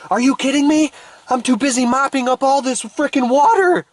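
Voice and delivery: very high exasperated mock yell; frantic indignation. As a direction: scream back like an overwhelmed mopper. Frantic mopper refuses the advice.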